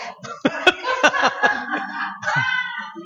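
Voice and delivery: high shrieking laugh. Real, not acted. Preacher laughing at his morgue story, high shriek. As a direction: crack up at the punchline.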